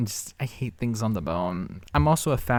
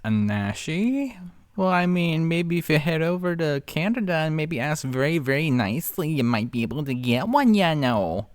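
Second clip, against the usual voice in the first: put-on higher-pitched, playful Canadian accent; 5 semitones higher than usual. Commentator joking about heading over to Canada, Canadian accent. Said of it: "Love doing that accent".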